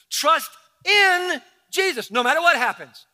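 a preacher's ringing call to trust; preaching climax. Shown as a demonstration. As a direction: proclaim with full force. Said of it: "emphatic sermon climax".

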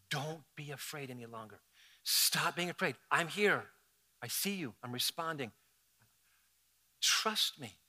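soft, low, tender comfort; Jesus calming a frightened believer; gentle reassurance. Acted. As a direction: speak peace to someone afraid. Jesus's words, softly reassuring.

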